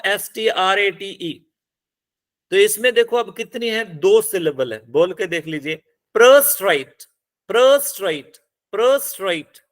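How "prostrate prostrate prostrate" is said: prostrate said as a model, weight on the second syllable; stress on second syllable. Shown as a demonstration. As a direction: reduce the first vowel of prostrate to schwa, stress the second. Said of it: "second पे". (prostrate, दूसरे syllable पर ज़ोर)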